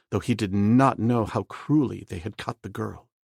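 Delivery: plain reading voice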